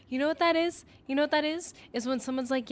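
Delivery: ordinary talk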